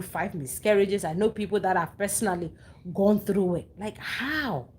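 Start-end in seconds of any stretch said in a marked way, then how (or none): 3.8-4.7 drawn-out, baffled rise and fall; disbelief. Real, not acted. Woman baffled by another's seventeen miscarriages, in disbelief. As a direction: stretch it out, unable to take it in.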